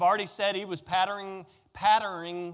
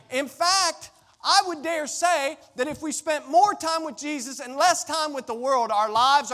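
higher, louder building sermon; fervent preaching; 8 semitones higher, 4 dB louder than usual. Acted. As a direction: drive it home with rising passion. Preaching at full force.

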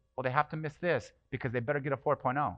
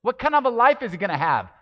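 high, loud, indignant imitation of the critics; loud mock voice of the people pressuring mom; harsh critics quoted; 8 semitones higher, 11 dB louder than usual. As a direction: throw their cruel words back, much louder and higher. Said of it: "they were being said to my mom".

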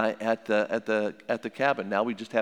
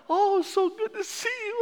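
high falsetto mock sweetness; exaggerated sugary hello; fake gushing greeting. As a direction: gush it like an insincere in-law.

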